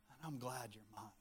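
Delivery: a gentle, intimate aside; tender voice of God. Acted. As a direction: murmur it like a loving father to his child. God voiced speaking to his kids.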